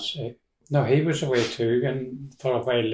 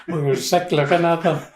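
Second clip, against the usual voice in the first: higher, through real laughter; laughing; 5 semitones higher, 3 dB louder than usual. Real, not acted. Recalling the endless potato peeling, laughing. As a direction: let the laugh carry the words.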